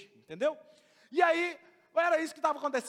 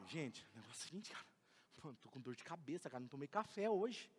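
much softer, lower, muttered complaint; grumbling disciple; 12 semitones lower, 16 dB quieter than usual. Acted. Disciple complains to friends, softly grumbling. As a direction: grumble under your breath about a headache.

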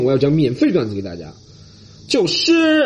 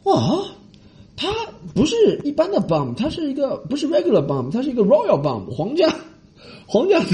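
awed gushing; 11 semitones higher than usual. Retold or imitated. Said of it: "中国人就会说".

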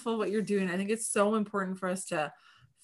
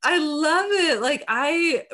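delighted; 8 semitones higher, 7 dB louder, much slower than usual. Real, not acted. Delighted about feeling everything.

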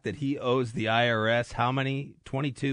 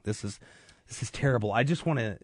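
normal talking voice

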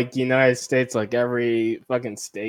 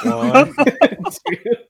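higher, laughing through numbers; laughing count; 10 semitones higher, 5 dB louder than usual. Real, not acted. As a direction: giggle out the count. Counting fidget spinner, laughing.

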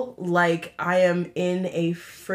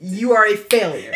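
laughing; 7 semitones higher, 9 dB louder than usual. Real, not acted. Joking rant, laughing.

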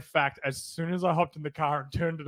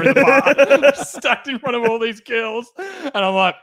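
louder, laughing through the punchline; 6 semitones higher, 7 dB louder, slower than usual. Real, not acted. Telling the boys about shoving his mate, laughing loudly. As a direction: crack up while telling it. Laughing retelling the payback shove.

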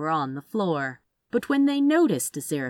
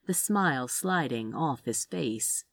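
ordinary delivery, nothing marked

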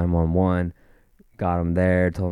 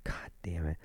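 very soft curse to himself; muttered frustration; 15 dB quieter than usual. Retold or imitated. Caller passed on to another office, quietly frustrated. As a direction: swear under your breath.

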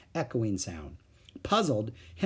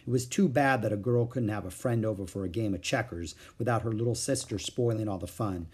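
plain reading voice

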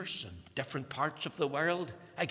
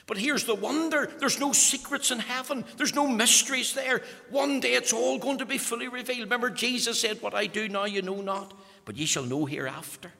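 raised, ringing pulpit voice; preaching at full force; 10 semitones higher, 5 dB louder than usual. Acted. Preacher proclaiming heaven to congregation, full force.

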